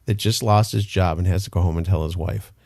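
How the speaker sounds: ordinary talk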